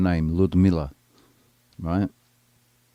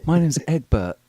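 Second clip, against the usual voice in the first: higher, giggly put-on lady voice; hammed-up female pickup reply; laughing mock woman; 9 semitones higher, 4 dB louder than usual. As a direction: play the woman, laughing through it. Acting out woman introducing herself.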